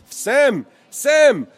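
loud, high repeated shouts; calling out repeatedly. A narrator's reading. American man calling his friend across the platform, shouting. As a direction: call him again and again. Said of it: "den Namen immer wieder rief".

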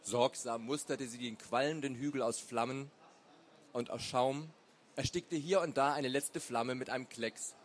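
usual speaking voice

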